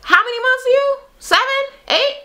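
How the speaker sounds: high taunting questions; imitating Minnie's high taunt; mock Minnie taunting. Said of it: "Minnie is like".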